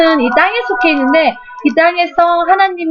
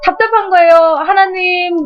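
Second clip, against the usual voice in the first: normal talking voice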